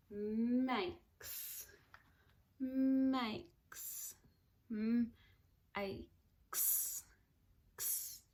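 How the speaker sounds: makes split into sounds; sounding out words. Shown as a demonstration. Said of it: "I can hear three sounds".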